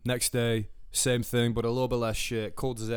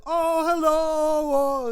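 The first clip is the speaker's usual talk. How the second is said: high, drawn-out mock Indian accent; exaggerated Indian-accented greeting, loud and slow; 6 dB louder, much slower than usual. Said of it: "I gave an Indian".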